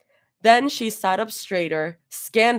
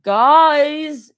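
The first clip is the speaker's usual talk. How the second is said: high, loud whiny plea; drunk whine; 8 semitones higher, 9 dB louder than usual. A narrator's reading. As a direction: whine like a tipsy friend. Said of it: "she whined softly".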